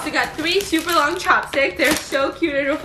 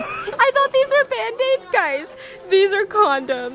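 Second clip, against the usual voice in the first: laughing; 7 semitones higher than usual. Real, not acted. Laughing at the mistake.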